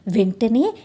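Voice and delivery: ordinary delivery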